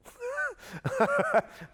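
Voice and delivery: sudden high laugh; burst of laughter. Real, not acted. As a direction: laugh at yourself, caught out. Laughs at own admission.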